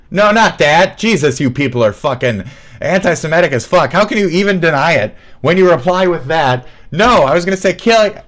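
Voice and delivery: loud, high-pitched indignant protest; exasperated outburst. Real, not acted. Streamer rebuking chat for a wrong guess, exasperated. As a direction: shout them down, appalled by the guess.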